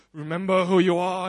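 low, booming ghostly father voice; vision's voice. Retold or imitated. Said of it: "the vision from him".

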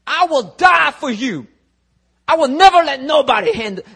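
high, loud, over-the-top pledge; acted fervent vow. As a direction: swear loyalty with hammed-up passion. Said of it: "Peter's like".

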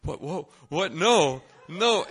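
rising, loud mock horror; alarmed protest. Giftless student hearing the plan, mock horror. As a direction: build from stunned to loud refusal. Reacting in horror to gift round.

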